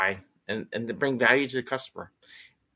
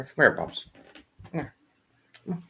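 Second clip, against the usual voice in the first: ordinary talk, nothing marked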